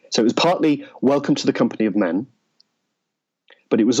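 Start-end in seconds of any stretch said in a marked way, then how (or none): none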